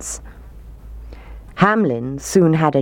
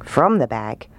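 usual speaking voice

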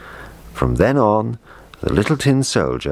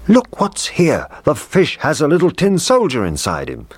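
pitched way up, astonished; excited discovery; 11 semitones higher than usual. A narrator's reading. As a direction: cry out in amazement at a find. Cook exclaims over discovery in fish.

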